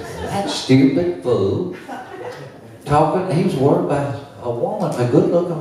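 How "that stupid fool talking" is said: high, drawn-out retold scorn; mock indignant disbelief. Dad lying in bed about the dancer, mock indignant disbelief. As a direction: marvel at the fool, pitch climbing.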